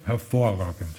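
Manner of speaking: talking with mouth full. Real, not acted. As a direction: speak through a mouthful of cake. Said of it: "Mit vollem Mund spricht man zwar nicht".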